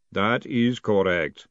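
very slow, deliberate golem. Acted. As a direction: confirm it word by word as a golem. Golem confirming in character voice.

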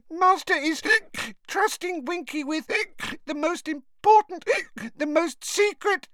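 high squeaky elf, hiccuping through tipsy words; drunk hiccuping elf. A narrator's reading. Drunken Winky answers Harry about her master, hiccuping.

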